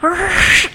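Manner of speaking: high mock bird squawk; short high bird-scream imitation; imitated bird screech. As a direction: screech like those loud birds. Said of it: "they're so loud".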